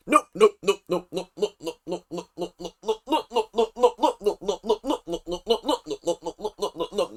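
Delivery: rattled-off staccato string of no's; lightly mocking, tumbling repeats; mimicking the coach. Imitating coach refusing crying children, mock stammered no's.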